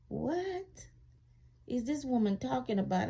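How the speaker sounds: sudden high-pitched disbelief; incredulous. Retold or imitated. Hearing the officer call her a fraud, high incredulous what.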